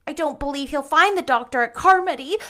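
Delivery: put-on a girl near tears, fearing the worst; sobbing. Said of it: "sobbed Diana".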